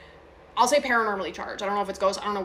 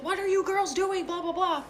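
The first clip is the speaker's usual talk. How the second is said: high-pitched mock yell of a freaked-out mom; retold yelling; 10 semitones higher than usual. Mom freaking out from the other room, high mock yell. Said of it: "she's, like, yelling".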